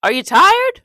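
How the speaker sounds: high, loud exaggerated question; papa asking. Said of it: "My papa looks at me and he says".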